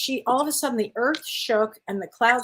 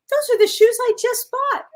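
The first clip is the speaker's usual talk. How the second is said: high, loud delighted exclaim; thrilled surprise, pitched way up; excited discovery; 13 semitones higher, 6 dB louder than usual. Retold or imitated. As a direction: squeal it like you just spotted your new shoes. Excited realisation retold from dream.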